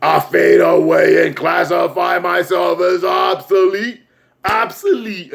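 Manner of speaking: slow, high proclamation in a put-on voice; Pennywise. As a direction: intone it grandly, stretching every word. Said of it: "was like".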